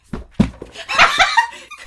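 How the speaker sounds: high shrieking laugh; imitated shriek laugh. Mimicking roommate's laugh behind closed door, shrieked high. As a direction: squeal of giddy laughter. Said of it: "you'd hear, like, this".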